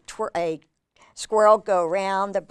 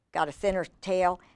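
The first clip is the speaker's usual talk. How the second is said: usual speaking voice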